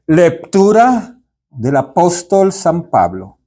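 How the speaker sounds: "Lectura" said as "leptura", slow, P instead of C; mock a reader's slip, "Lectura" with a P; imitating a mispronunciation. Mimicking a parish reader who says "Lectura" as "leptura". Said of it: "ponía una P en vez de una C".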